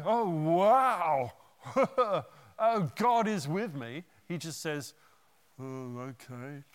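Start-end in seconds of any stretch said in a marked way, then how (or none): 0.0-4.0 louder, excited with a laugh; hammed-up joy at God's help; mock delight. What Elijah should have said. 5.6-6.6 softer and slow, unimpressed; flat shrug. Retold or imitated. Elijah's flat reply.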